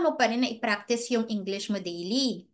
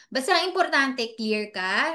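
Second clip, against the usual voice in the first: ordinary delivery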